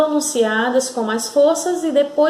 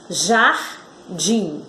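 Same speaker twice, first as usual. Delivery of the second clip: "Jardim" broken in two with a pause; syllable by syllable; 6 semitones lower than usual. Shown as a demonstration. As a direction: say "Jardim" as jar, pause, dim. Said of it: "Quantas sílabas? Duas".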